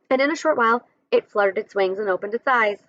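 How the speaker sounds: usual speaking voice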